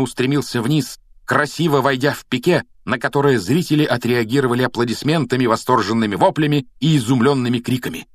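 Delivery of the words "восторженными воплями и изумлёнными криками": plain reading voice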